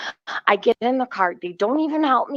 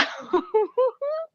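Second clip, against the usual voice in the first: shrill pitched-up cackle; high squealing laugh; 3 dB quieter than usual. Real, not acted. Laughing at her own story.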